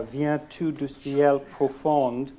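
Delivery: "Viens-tu du ciel profond" spoken slowly in a self-admittedly bad French accent; French line. Shown as a demonstration. Poet quoting Baudelaire to the audience, slow halting French. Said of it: "my French accent is so bad".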